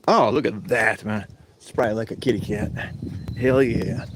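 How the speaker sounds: normal talking voice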